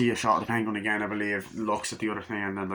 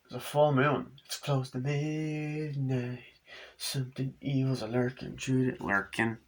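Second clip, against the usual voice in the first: spooky storyteller; 3 semitones higher than usual. Acted. Spooky scene setting.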